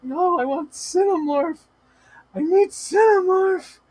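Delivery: very high, drawn-out pleading; mock whining demand. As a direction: whine for it in a hammed-up tantrum. Acting out refusal of other streamers.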